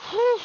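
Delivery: short, high, loud puff of breath; sharp exhale of relief; whew. Real, not acted. Worn out after the rant.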